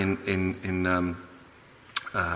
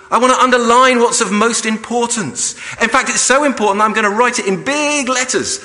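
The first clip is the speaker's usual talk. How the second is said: loud, high, urgent demand for the pen; passionate Paul; 9 semitones higher, 5 dB louder than usual. Retold or imitated. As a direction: seize the pen and insist, fervent. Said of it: "reaching this climax and saying".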